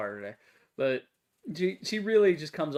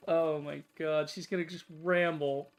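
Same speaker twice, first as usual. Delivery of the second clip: ordinary delivery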